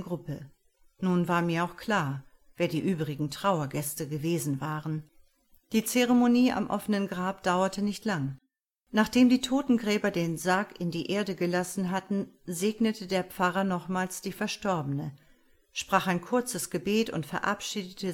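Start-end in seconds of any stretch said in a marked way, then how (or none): none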